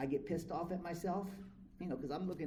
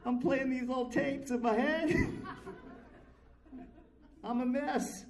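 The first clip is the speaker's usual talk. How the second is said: laughing; 10 semitones higher, 5 dB louder, slower than usual. Real, not acted.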